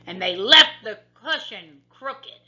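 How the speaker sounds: loud, great big bear voice; father bear growl. A narrator's reading. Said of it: "growled the father bear in his great big voice".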